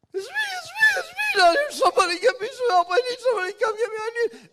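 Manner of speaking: frantic shouting into the radio, voice up high and cracking; stranded pilot panicking over the radio, babbling very high and loud; panicked high-pitched babbling. Retold or imitated. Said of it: "my voice was up about three octaves".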